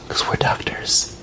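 slow, hushed aside in the doctors' voice; mock confiding whisper. Storyteller imitating doctors sure of their verdict, mock whisper. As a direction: whisper it as if it settles everything. Mocking the doctors' smug certainty.

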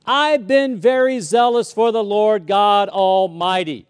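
loud self-righteous boast; proud boast. Retold or imitated. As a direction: declare it like a resume. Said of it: "He's like".

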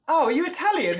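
high-pitched mock discovery; imitating someone amazed; mock surprise. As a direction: act out a belated realisation in a high voice. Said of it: "they're like".

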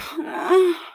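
high, loud whimpering sigh; high-pitched whimper. Acted. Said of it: "I let out this loud whimper".

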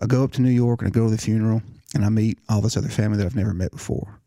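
ordinary delivery

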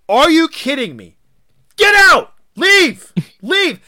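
shrieking very high and loud, hammed up; mock furious shout. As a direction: throw him out over a sneeze. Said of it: "he's like".

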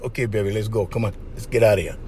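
low, soft and easy, then hurried on the last line; easy smooth lead. Retold or imitated. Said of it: "you're like".

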